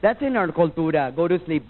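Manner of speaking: ordinary talk, nothing marked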